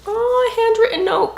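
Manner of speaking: high, excited oohing; thrilled, voice shooting up high; delighted surprise. Real, not acted. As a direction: coo with delight at a sweet find. Finds a handwritten note, thrilled.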